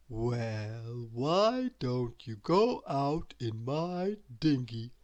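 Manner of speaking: slow, drowsy lazy drawl; lazy Mister Lazy. A narrator's reading. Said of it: "replied Mister Lazy".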